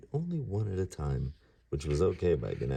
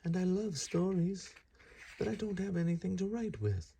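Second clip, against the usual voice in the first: put-on much higher keen kid voice; eager young god. Ganesha telling Vyasa, a much higher eager voice. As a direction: sound eager then stuck. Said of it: "said Ganesha".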